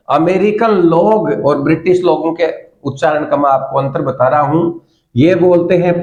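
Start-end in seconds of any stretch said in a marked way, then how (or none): none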